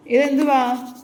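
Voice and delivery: real scolding question; loud challenge. Real, not acted. Mother questioning child's mistake.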